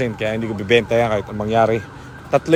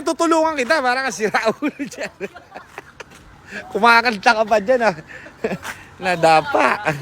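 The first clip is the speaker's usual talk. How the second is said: high, squealing laughter through the words; laughing hard; 13 semitones higher than usual. Real, not acted. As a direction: crack up while teasing a friend who fell. Friend falls, speaker cracks up. (humahagalpak sa tawa habang nagsasalita)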